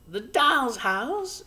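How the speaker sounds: very high, slow and wistful; a tiny mother dreaming aloud; Homily's voice. A narrator's reading. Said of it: "she said".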